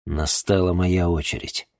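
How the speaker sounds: ordinary delivery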